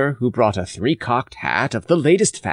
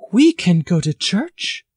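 higher, lightly voiced timid girl; timid maid; 9 semitones higher than usual. A narrator's reading. Catherine shyly suggests church to her father, higher timid voice. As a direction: venture it shyly. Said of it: "ventured the maid timidly".

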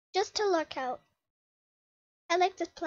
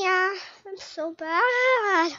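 ordinary talk, nothing marked

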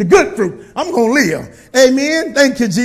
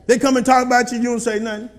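usual speaking voice

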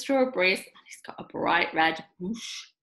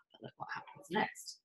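whispered, much softer teaser; suspense whisper; 14 dB quieter than usual. Real, not acted. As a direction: hush them for what comes next. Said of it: "storyteller whispers suspense".

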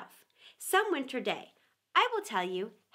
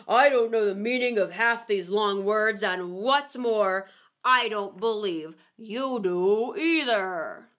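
loud, slow put-on creature voice; heckling Eaglet; 6 dB louder, slower than usual. A narrator's reading. Eaglet accusing the Dodo of bluffing, loud and scornful. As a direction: protest loudly, dragging out the last phrases.

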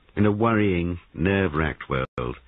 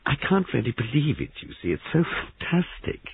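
light breathless wondering, trailing up; half apology, half marvel; apologetic; 10 semitones higher than usual. Acted. Molly to the sergeant, apologetic and wondering. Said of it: "Molly said apologetically".

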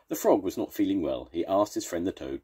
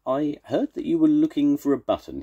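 high, louder animal character; raccoon voice; 10 semitones higher, 5 dB louder than usual. A narrator's reading. Raccoon offering Toad a found button, high character voice. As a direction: pitch it up high as a helpful raccoon.

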